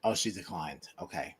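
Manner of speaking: low, soft whispered echo. Real, not acted. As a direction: whisper it back. Echoing whisper.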